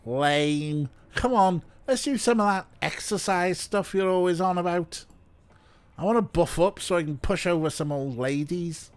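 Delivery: lightly put-on bad Scouse; bad Scouse accent. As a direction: goad a pal in fake Scouse. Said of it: "I'm doing a bad Scouse".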